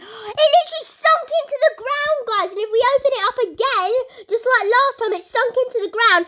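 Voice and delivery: can't believe what just happened; excited disbelief. Real, not acted. Gamer telling viewers the car just sank: high, loud, amazed. Car sinks a third time.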